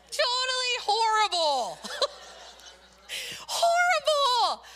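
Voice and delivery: high, laughing cry of horrible; laughing disbelief. Real, not acted. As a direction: laugh through the outrage at the plot. Laughing over a sad TV plot.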